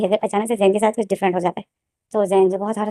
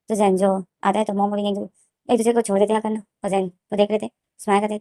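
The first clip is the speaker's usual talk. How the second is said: ordinary delivery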